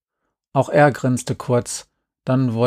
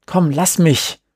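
higher, louder brush-off; called out; 5 semitones higher, 4 dB louder, slower than usual. Acted. Boy pulls away from a teasing friend, calling out. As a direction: shove him off with your voice. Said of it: "rief ich und drehte mich weg".

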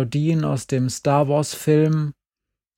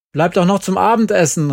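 loud, higher, eager invitation; called out; 6 semitones higher, 6 dB louder, faster than usual. A narrator's reading. Lonely father calls loudly, begging them to stay for dinner. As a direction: call it across the yard, pleading a little. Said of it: "rief er".

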